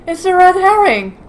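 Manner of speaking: high, loud and eager; excited. Real, not acted. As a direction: call it out, thrilled. Excited reaction to game find.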